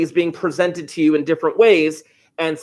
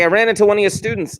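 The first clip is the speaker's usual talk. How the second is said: quick, higher Brooklyn dad imitation; major Brooklyn accent; 5 semitones higher, faster than usual. Dad reporting on one of his son's students, Brooklyn accent. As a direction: mimic dad's Brooklyn accent, fast and pitched up. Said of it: "a major Brooklyn accent".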